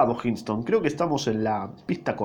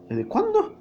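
much higher, startled rising ask; shocked question; slower than usual. Acted. Captain asks the dead grandmother, shocked and high. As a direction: jump up in disbelief.

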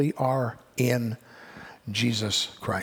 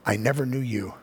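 the Lord's rejection; 2 semitones lower than usual. Acted. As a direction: let the sentence fall heavy. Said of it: "hear the words".

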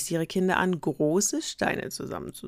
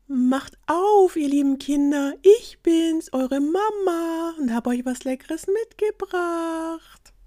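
wolf faking a much higher, slow sweet mother voice; fake soft mama voice; 13 semitones higher, 6 dB louder, slower than usual. Wolf at the door pretending to be mama, high and sugary. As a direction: coo it sweetly to trick them. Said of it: "damit seine Stimme weicher klang".

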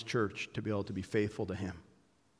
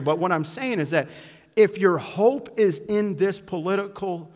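louder, higher fired-up sermon build; raised preaching voice climbing toward the climax; preaching at full force; 6 semitones higher, 8 dB louder than usual. Acted. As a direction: drive it home like a pulpit preacher.

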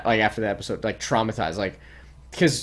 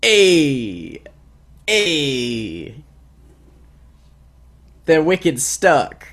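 put-on slow, loud Boston accent; 4 semitones higher, 4 dB louder, much slower than usual. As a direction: lay on a thick Boston accent. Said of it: "you know how they sound in Boston".

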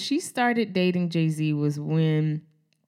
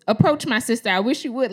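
higher, louder mock dare; challenging little sister; 6 semitones higher, 5 dB louder, faster than usual. Little sister dares men in the mall, mock-fierce. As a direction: play a kid itching to fight. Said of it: "so Raven goes".

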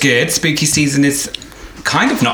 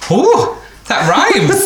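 laughing delight; 13 semitones higher, much slower than usual. Real, not acted. Delighted at a rhyme.

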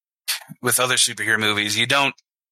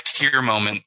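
ordinary talk, nothing marked

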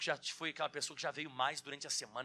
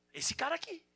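emphatic high rise; 11 semitones higher, 4 dB louder than usual. Acted. Pointing at Peter's example.

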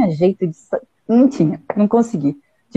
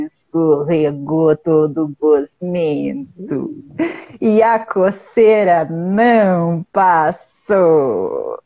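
slow storytelling; 6 semitones lower, much slower than usual. A narrator's reading.

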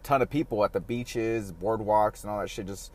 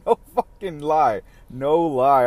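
ordinary delivery

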